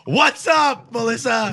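playful shout out; calling out. Real, not acted. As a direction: shout hello to her. Calling out to a listener.

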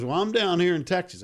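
high Texas twang; Texas accent. Retold or imitated. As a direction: voice the Texas caller. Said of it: "I'm doing a Texas accent".

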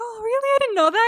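high, loud, hammed-up astonishment; exaggerated high-pitched reaction; mock surprise. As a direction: squeal it in put-on surprise. Retold surprised reply to confession.

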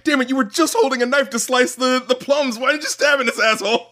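high, frustrated mock yell at the screen; exasperated outburst. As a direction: scold the character like you're watching live. Reacting to the stabbing scene.

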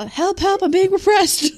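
high, loud, slow cry of a mock-oppressed peasant; hammed-up movie peasant yelling help; Monty Python peasant. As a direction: wail it like a put-upon peasant in a sketch. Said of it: "I love that movie".

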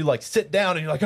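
ordinary talk

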